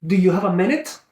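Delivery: "minute" rising high as a question; questioning tonality. Shown as a demonstration. Sales coach asking the seller for time, questioning tonality. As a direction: lift "minute" to ask for their time. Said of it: "question mark".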